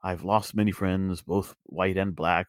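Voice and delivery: mock noble hero. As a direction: drag it out like a self-important old adventurer. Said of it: "there's this scene where he's like".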